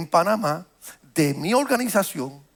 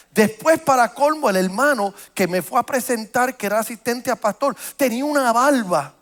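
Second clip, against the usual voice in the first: loud, impassioned preacher climbing higher; louder, higher pulpit storytelling; full-force preaching; 4 semitones higher, 5 dB louder than usual. Acted. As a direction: preach it out, building up the fire.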